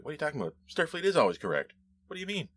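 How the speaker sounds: high, indignant mock officer voice; mock stuffy officer. Podcast host mocks a rule-bound Starfleet loyalist, high indignant voice. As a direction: lightly play a by-the-book officer, outraged.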